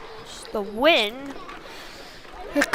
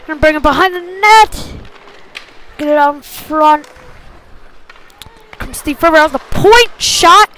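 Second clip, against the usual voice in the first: excited call; 3 semitones higher, 8 dB louder than usual. Real, not acted. Rush toward the net, shot.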